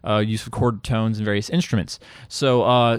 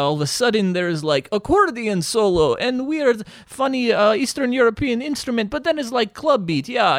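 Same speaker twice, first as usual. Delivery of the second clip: raised-pitch enthusiastic pitch; excited; 9 semitones higher than usual. Real, not acted. Guest hyping turbo folk to listeners, excited. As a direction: gush about the music, voice climbing.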